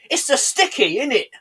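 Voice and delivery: mock dad voice, very strong London accent. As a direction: answer in broad, loud London speech. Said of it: "in a very loud, very strong London accent".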